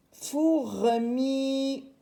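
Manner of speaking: Fourmi stretched as fou-re-mii; drawn-out teacher's reading of Fourmi; syllable by syllable. Shown as a demonstration. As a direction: slowly sound out Fourmi, vowels held. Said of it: "Je vais mettre fourmar".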